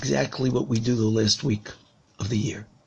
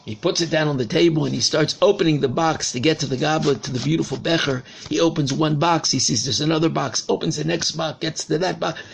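usual speaking voice

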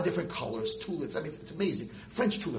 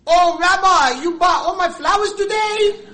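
very high, loud mock shopkeeper; hammed-up delighted florist; Korean florist; 17 dB louder, slower than usual. Korean florist calling out to the rabbi, loud and high. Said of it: "the Korean guy behind me goes".

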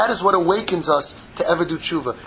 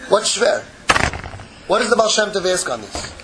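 normal talking voice

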